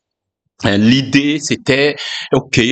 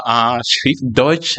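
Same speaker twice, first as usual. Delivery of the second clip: slow, careful German pronunciation; German word; much slower than usual. Shown as a demonstration. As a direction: sound out Schriftdeutsch in German. Says the German word again.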